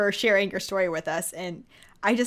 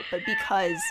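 laughing. Real, not acted. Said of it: "laugh shout".

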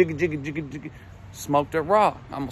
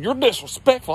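higher-pitched offended protest; put-on indignation, pitched up; mock indignant uncle; 6 semitones higher than usual. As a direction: protest it high, imitating an affronted uncle. Uncle acted as indignant in quarrel.

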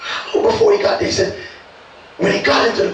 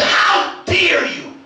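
slow, high, indignant How dare you; stern rebuke; 12 semitones higher, much slower than usual. Acted. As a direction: draw it out in outrage. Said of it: "He said".

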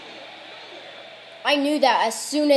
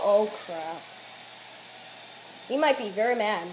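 ordinary delivery

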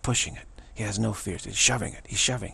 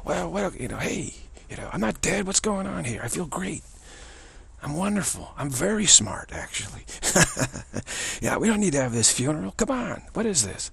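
high, brash mock swagger; swaggering Trump; 7 semitones higher than usual. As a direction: stride in like you own the place. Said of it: "Trump comes striding it down the aisle".

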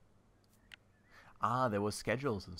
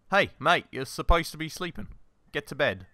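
higher, louder call to a mate; mock scolding; 6 semitones higher, 5 dB louder than usual. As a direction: playfully order someone to bed. Scolds game character to go to bed.

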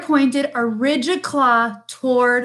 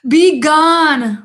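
loud, raised bear roar; 4 semitones higher, 7 dB louder than usual. A narrator's reading. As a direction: roar the order to leave. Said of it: "roared the bear".

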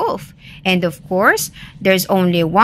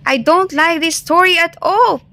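high-pitched, lightly alarmed rabbit; a touch of panic, pitched high; mild panting alarm; 10 semitones higher, 3 dB louder than usual. A narrator's reading. Rabbit chased by Wolf, lightly played alarm. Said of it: "panted Rabbit as Wolf chased".

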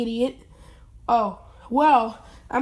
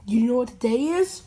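normal talking voice

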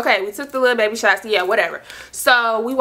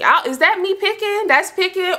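high, squeaky disbelief at herself; incredulous question; 10 semitones higher than usual. Real, not acted. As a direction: pitch it way up like you can't believe it. Asking viewers if she's petty.

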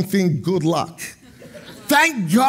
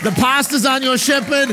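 full-force preaching; 6 semitones higher than usual. Real, not acted. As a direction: preach up to a shouted climax. Preaches to a climax.

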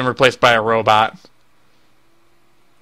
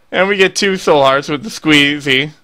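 ordinary talk, nothing marked

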